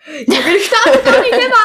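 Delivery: laughing while talking; giggling through the words; stifled giggles. Real, not acted. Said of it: "Hör auf zu lachen".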